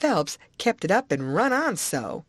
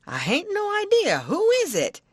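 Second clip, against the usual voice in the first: much higher, eager question; puzzled old man; 13 semitones higher than usual. Acted. Uncle to wife, much higher eager question. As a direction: press for the answer. Husband asks.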